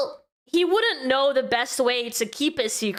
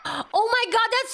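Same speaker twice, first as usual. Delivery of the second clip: shocked gasp; 7 semitones higher than usual. Real, not acted. Shocked at a mean drawing.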